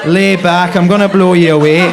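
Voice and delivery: loud, level Belfast threat; Belfast accent. Acted. Sexually terrifying Belfast lover, loud put-on Belfast accent. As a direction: seduce like a menace, in Belfast accent. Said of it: "someone with a Belfast accent".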